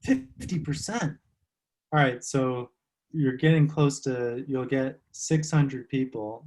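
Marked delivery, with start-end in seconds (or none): 0.0-1.2 high, drawn-out questioning repeat; surprised echo. Real, not acted. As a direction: repeat the figure slowly in real surprise. Reacts to the reported figure.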